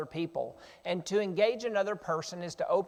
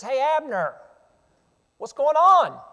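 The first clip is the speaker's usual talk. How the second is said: high, loud taunting call; yelling out; 7 semitones higher, 9 dB louder than usual. Acted. David calling to the sleeping king's general, yelling out. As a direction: holler it over to someone far away. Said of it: "he's yelling out to Abner".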